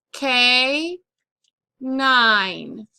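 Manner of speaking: "K nine" slowly, each syllable drawn out and apart. Shown as a demonstration. Teacher dictating canine to students, syllable by syllable. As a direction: break it up for spellers, pause between "K" and "nine".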